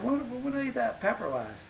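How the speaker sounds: suddenly alarmed, voice up; surprised. Retold or imitated. Realizes he's still burning.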